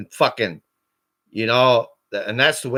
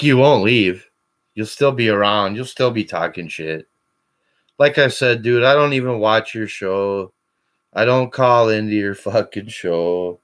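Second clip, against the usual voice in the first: usual speaking voice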